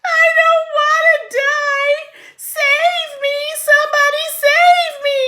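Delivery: terrified pleading. Acted. Pig wailing to anyone for help. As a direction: beg for your life in panic.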